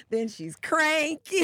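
ordinary delivery

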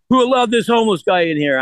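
hammed-up disdainful sneer; mock snob. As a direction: sneer it like a stuck-up skate boss. Mocking a skater's snobby remark.

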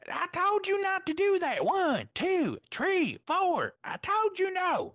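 very high-pitched mock-scolding referee; high hammy impression of a scolding ref; Mark Briscoe. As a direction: scold in a high, sustained squawk, counting it out. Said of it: "on the point of Mark Briscoe".

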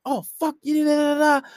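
high-pitched imitation of cursing; mock cursing out. Girlfriend cursing out her man, high mock rant. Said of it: "His girl be cursing him out".

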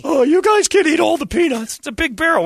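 sarcastic store clerk. Retold or imitated. Store clerk sarcastically scolds the peanut eaters, high and loud.

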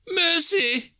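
very high-pitched plea; terrified Scrooge. A narrator's reading. Scrooge on his knees before the phantom, terrified pleading. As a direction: beg for your life in a high voice.

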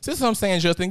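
ordinary talk, nothing marked

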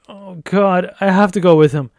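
loud, high anguished moan. A narrator's reading. Woman giving in to the demon's pull, moaning in anguish. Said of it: "Haley moaned".

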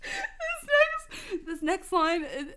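struggling to speak through laughter; helpless giggles breaking up the words; laughing too hard. Real, not acted. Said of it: "I'm laughing so hard".

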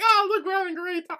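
very high, fast, gushing put-on voice; mock oblivious glee. Mimicking the girl smiling through the dance, mock oblivious glee. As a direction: squeal it like a clueless happy dancer.

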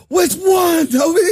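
loud high yell; shouting. Pretend. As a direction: bellow the question at him. Acted quarrel, mock confrontation.

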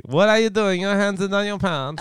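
put-on high Borat accent. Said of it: "hard not to do the accent again".

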